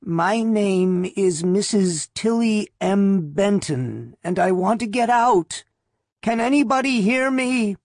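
put-on a feeble old woman calling up, muffled; faint old woman. A narrator's reading. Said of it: "muffled and faint".

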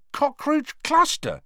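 high, slow, amazed question. A narrator's reading. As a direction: ask it in disbelief. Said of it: "he said, amazed".

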